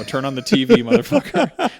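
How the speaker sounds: loud, high, laughing jab; answering the ER doctor's president question, loud laughing mock yell; mock outburst. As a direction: throw it at the doctor like a punchline.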